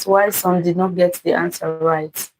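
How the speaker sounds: usual speaking voice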